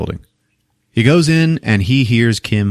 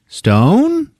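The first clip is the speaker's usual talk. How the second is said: high calling out; 14 semitones higher than usual. Retold or imitated. Kim calls out from the shower.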